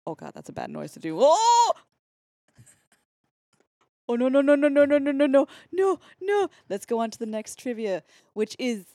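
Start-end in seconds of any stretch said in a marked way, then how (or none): 1.2-1.7 sudden high yelp of alarm; loud startled oh. Real, not acted. Reacting to her own bad noise, a loud high yelp. 4.1-6.5 rapid high loud string of no; panicked no no no. Real, not acted. Horrified by the noise she just made, loud rapid protest.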